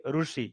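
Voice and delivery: ऋषि said as "ru-shi"; non-Hindi-region pronunciation. Shown as a demonstration. Teacher shows how non-Hindi speakers say it, ऋषि as "ru-shi". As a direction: give ऋषि an oo vowel after the r. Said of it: "अहिंदी भाषी क्षेत्र लोग क्या बोलेंगे इसको?".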